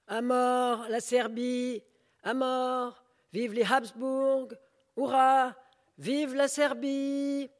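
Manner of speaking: high, loud chanting shouts; patriotic yelling. Acted. Demonstrator yelling slogans at the parade crowd, loud. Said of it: "criant sans cesse, ... tout enroué".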